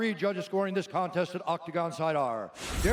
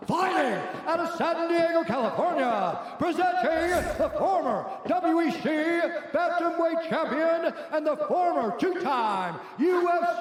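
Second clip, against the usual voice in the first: booming ring announcer; 8 semitones higher, 4 dB louder than usual. Acted. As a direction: boom it out like a title-fight announcer.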